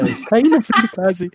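a lightly hammed-up careless excuse; mock offhand excuse. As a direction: brush off the fall to mum like it was nothing. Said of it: "Eu falei".

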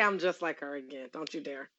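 quiet don't-you-dare threat; low warning. Real, not acted. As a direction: warn her under your breath. Scolding TV character.

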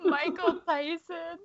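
slow, high mock drawl of the name. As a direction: stretch the name out in a high silly voice. Friends repeating the name mockingly.

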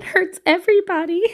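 high-pitched, hammed-up hurt; mock lament. Parent lamenting kids crying themselves to sleep, mock anguish. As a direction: playfully wail in sympathy.

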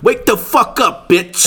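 high, drawn-out mock wake-up call; imitated wake-up call. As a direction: imitate a rude alarm-clock voice, stretched and high. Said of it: "imagine if this motherfucker woke you up".

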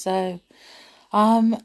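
ordinary delivery

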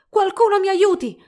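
high, loud panicked shout; cry for help. A narrator's reading. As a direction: scream for someone to rescue you. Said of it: "gridò saltellando come una marionetta".

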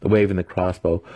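ordinary delivery, nothing marked

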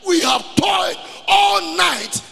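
slow, high, very loud proclamation; preaching shout. Real, not acted. Preacher quoting Peter to the congregation, preaching shout. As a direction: thunder it out word by word, easing at the end.

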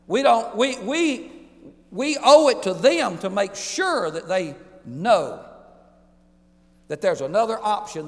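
pleading for people to reach heaven; fervent preaching. Real, not acted. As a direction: urge the congregation with full fire. Preacher climaxes sermon.

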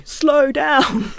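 laughing. Retold or imitated. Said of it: "and also".

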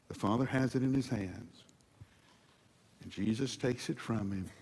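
ordinary talk, nothing marked

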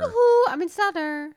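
high, loud Swedish yoo-hoo; Swedish accent. Pretend. Co-host interrupts the plot as the daughter, hammed-up Swedish accent. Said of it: "Are you Swedish?".

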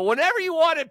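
high, quick and bright offer; excited. Real, not acted. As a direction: burst out with the offer. Offering the gift to Johnny.

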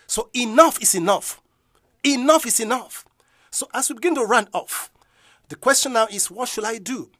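loud, high enough is enough; shouted declaration. Acted. Preacher declaring enough to the congregation, loud. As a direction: declare it with force.

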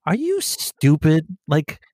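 high, incredulous scoff; mock disbelief. Cop mocking the arrestee about Miranda, in high disbelief. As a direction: talk down like he's an idiot. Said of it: "And you're just like".

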